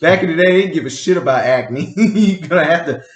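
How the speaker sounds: amused protest through laughter; laughing while talking. Real, not acted. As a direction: crack up as you make the point. Joking retort, cracking up while talking.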